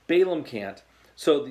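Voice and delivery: normal talking voice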